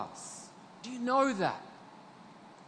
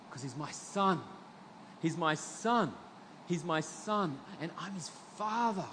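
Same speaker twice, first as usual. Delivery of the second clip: tender; 7 dB quieter than usual. Acted. Tender reflection on fatherhood.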